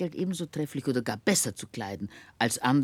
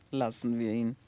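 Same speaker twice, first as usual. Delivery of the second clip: usual speaking voice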